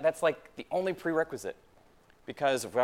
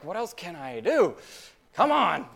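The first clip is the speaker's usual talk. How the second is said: impatient prayer; 5 semitones higher, 5 dB louder than usual. Retold or imitated. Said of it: "I'm like".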